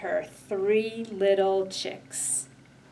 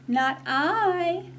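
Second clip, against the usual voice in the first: high meowy kitty voice; meowing cat voice; 9 semitones higher than usual. Acted. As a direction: mew it like a cat. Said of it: "meowed the cat".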